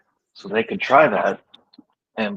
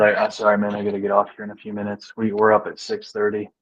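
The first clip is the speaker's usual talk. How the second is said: ordinary talk, nothing marked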